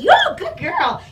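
high, loud, drawn-out praise; exaggerated slow cheer of approval; making a big deal. Real, not acted. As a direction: gush over the dog. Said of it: "I make a big deal".

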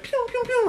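high laser pews; blaster pew sounds. Retold or imitated. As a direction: zap out blaster noises.

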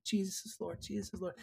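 very soft imitation of a weakened voice; small, hushed retold declaration; meek repetition. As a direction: repeat it faintly after a prompt. Imitating screaming girl's meek repetition.

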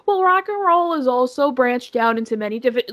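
put-on voice of someone arguing a technicality; mock objector. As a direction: lightly parody a know-it-all's counterargument. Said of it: "people's argument is gonna be like".

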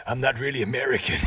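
a mild put-on American accent; bad American accent. Dying man admits in a bad American accent. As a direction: confess in a weak American accent. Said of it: "It's just a bad accent".

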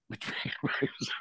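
breathy laugh through the words; laughing echo. Real, not acted. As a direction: chuckle the words out quietly. Echoing a funny remark, laughing.

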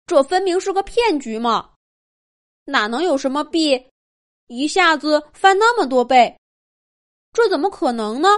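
put-on young mouse calling out a scam; indignant little mouse.

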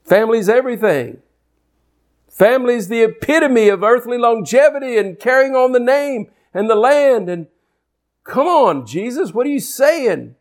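high-pitched, acted-out objection from the crowd; incredulous protest. Voices the shocked crowd answering Jesus.

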